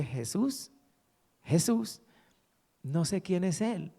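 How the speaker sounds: retold bewilderment, softly questioning; puzzled young woman. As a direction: echo the name as an unknown word, puzzled. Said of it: "hacer unos gestos con su rostro como". (desconcierto, como quien no entiende)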